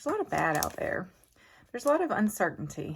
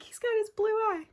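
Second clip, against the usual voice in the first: lightly voiced small boy, much higher; kid's voice. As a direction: pipe it up like an excited little boy. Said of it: "Wesley's like".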